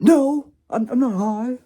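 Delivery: high, loud indignant outburst; angry denial. Retold or imitated. Caught high by his brother, angry denial. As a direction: yell it back, high and defensive. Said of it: "I got really angry".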